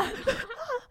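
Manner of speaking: 高亢短促的笑声; 忍不住尖声笑出来; 尖声大笑. Real, not acted. 讲完糗事大笑. (尖声短笑)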